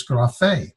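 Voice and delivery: grafe with the weight on fe; grafe, second syllable stressed and held; stress on last syllable. Shown as a demonstration. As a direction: say grafe slowly, accenting the final fe. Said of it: "The accent is over the last syllable".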